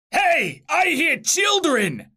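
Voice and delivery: high, drawn-out demon call; high-pitched demon hollering round a corner; demon's yell. A narrator's reading. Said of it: "yelled a demon from around the corner".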